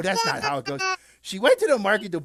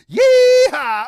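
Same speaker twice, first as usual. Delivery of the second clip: very high, very loud yeehaw; cowboy yell; 14 dB louder than usual. Acted. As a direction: whoop like a rodeo rider. Dog riding a goat.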